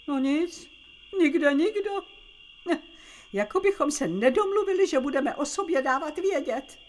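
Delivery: ordinary talk, nothing marked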